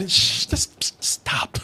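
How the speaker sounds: whispered shushing; hushed plea. Acted. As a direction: whisper it, begging someone to quit. Imitates people begging him to stop.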